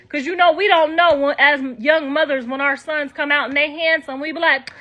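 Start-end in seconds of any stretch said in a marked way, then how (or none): none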